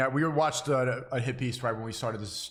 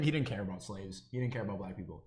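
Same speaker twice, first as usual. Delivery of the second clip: low muttered aside; 6 semitones lower, 8 dB quieter than usual. Real, not acted. Dismissive claim about Lincoln.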